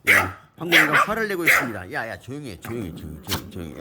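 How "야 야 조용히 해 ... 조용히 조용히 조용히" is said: soothing shh to a barking dog; soft hushing. Real, not acted. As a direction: softly hush the dog, much quieter. (작게 달래며 조용히 시키기)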